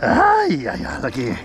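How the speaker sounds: startled ay ay ay; excited yelp. Real, not acted. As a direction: cry out as the fish comes up. Lifting the fish from tank.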